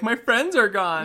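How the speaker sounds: high, slow mock lament; hammed-up whimper of grief; mock heartbreak. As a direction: draw it out in pretend despair. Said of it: "you're like".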